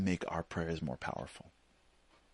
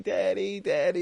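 put-on high, loud whiny kid calling Dad; mock tearful little-kid call; imitated crying child; 11 semitones higher, 6 dB louder than usual. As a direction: whine for Daddy's attention, pitched up. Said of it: "comes crying".

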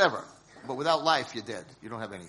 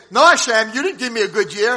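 indignant complaint; 8 semitones higher, 11 dB louder than usual. Acted. Complaining to Hashem.

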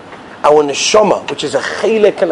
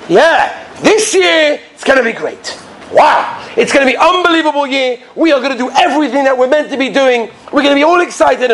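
high-pitched, hyped-up enthusiasm; mock excitement; 9 semitones higher than usual. Congregants hyping up their new year, excitedly. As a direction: gush about the great year ahead. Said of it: "We're so excited".

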